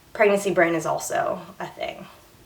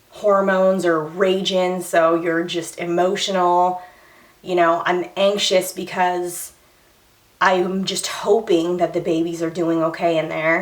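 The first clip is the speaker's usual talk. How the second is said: ordinary talk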